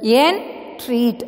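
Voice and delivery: "Entreat" in two parts, en, treat; low, divided "Entreat" for learners; syllable by syllable. Shown as a demonstration. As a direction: pause between en and treat in "Entreat". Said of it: "entreat is divided into two syllable".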